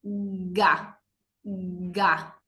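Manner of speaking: Ga voiced at the back of the throat; g sound demo. Shown as a demonstration. Teacher showing ga articulated in the throat. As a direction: press Ga out from the throat. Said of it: "se articula en la garganta".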